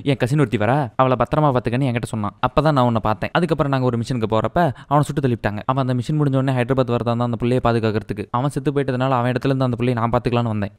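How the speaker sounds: low, level-pitched hero telling his backstory; mock flashback recital. Hero recounting his Kashmir childhood and army past, mock-solemn recital. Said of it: "flashback சொல்றியா?".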